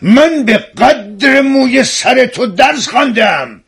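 high, slow, indignant character voice; drawn-out, high-pitched quarrelsome outburst; aggressive retort. Acted. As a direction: throw it out as a heated challenge. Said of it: "با لحنی پرخاشگرانه گفت".

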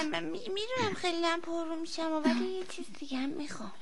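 shy murmured plea; timid request. Acted. Child timidly asks another favour.

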